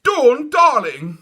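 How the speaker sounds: high wailing mother; anguished cry. A narrator's reading. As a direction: rush to comfort her child. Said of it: "cried Dawn's mother".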